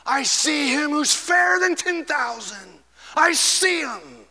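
high, slow, awestruck; ecstatic, pitched high; dying man's rapture. Retold or imitated. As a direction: gasp it out as he sees the Lord. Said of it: "with a gasp, he said".

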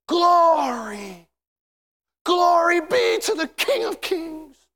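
high, drawn-out cry of praise; exultant shout. Acted. Angels praising the King of Kings, exultant shout. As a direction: belt it out high and long. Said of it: "when you hear the angels say".

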